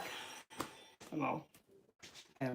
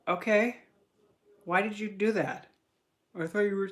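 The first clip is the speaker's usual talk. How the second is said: higher-pitched retold challenge; mock-questioning, pitched up; retold questioning protest; 6 semitones higher, 4 dB louder than usual. Retells own annoyed question to him.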